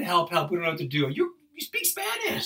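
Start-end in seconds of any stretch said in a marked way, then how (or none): 1.1-2.5 high, slow exasperated squeal; exasperated reproach. Retold or imitated. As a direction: stretch it out in incredulous reproach. Retold scolding of Spanish-speaking friend.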